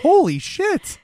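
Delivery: hammed-up astonishment; mock shock. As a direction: gasp it out amazed. Shocked reaction in retold scene.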